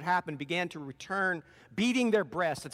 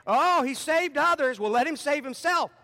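mocking sneer; 9 semitones higher, 6 dB louder than usual. Rulers sneering at Jesus on the cross, loud mocking taunt. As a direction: jeer at him from the crowd.

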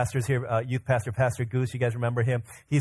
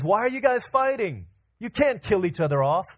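mild acted rebuke, raised in pitch; exasperated rebuke; 8 semitones higher than usual. Moses to two of his own people arguing, exasperated rebuke. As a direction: break up a fight, voice pitched up.

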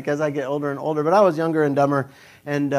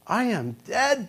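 scared; 6 semitones higher, 4 dB quieter, slower than usual. Retold or imitated. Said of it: "I'm getting scared again".

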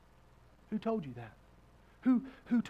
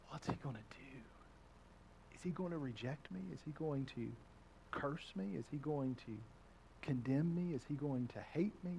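hushed, much softer anxious worry; fearful inner voice; 5 semitones lower, 13 dB quieter than usual. Acted. Sinner fearing God's rejection, hushed and anxious. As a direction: murmur it as a frightened thought.

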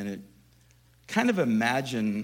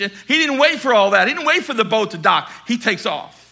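loud, fervent preacher drive; preaching at full force; 7 semitones higher, 11 dB louder than usual. Acted. Preacher to congregation, fervent loud preaching. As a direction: sell Peter's eager rush. Preacher building on Peter's leap.

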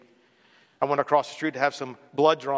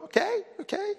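calming myself; 5 dB quieter than usual. Retold or imitated. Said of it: "I go".

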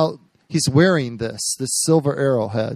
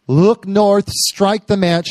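prophetic proclamation; 7 semitones higher, 4 dB louder, slower than usual. Retold or imitated. Preacher voices prophet's proclaimed word.

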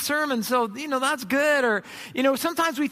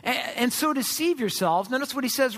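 ordinary delivery

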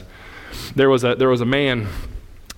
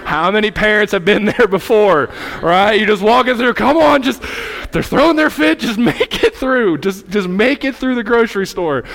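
higher, louder impassioned preaching; full-force preaching; 4 semitones higher, 5 dB louder than usual. Acted. Pastor pleading with the church, full-force preaching. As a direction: preach it out loud and lifted.

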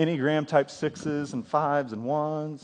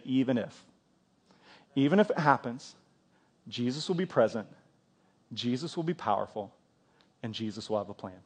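calm confession of faith; 5 semitones lower, 7 dB quieter than usual. Shown as a demonstration. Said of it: "you should be able to say".

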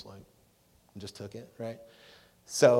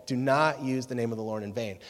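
normal talking voice

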